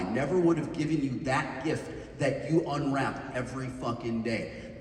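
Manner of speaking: normal talking voice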